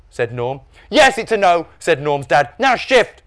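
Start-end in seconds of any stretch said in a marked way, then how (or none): 0.9-1.7 high, loud reply; irritated refusal. A narrator's reading. Dad refusing Norm money, irritated. 2.6-3.2 high, loud command; impatient order. A narrator's reading. Dad moving Norm aside, impatient.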